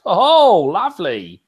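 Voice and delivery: high, loud drawn-out whoop, falling away; real gleeful cheer at the chaos; delighted exclamation. Real, not acted. Host watching fans get involved in a match scuffle, delighted exclamation.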